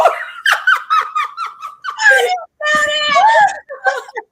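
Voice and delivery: loud high shrieking burst of laughter. Real, not acted. Host cracking up over guest's headline slot, shrieking laugh. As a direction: let out a huge squealing laugh.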